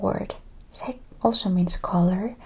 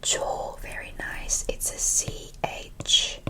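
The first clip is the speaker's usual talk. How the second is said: ordinary talk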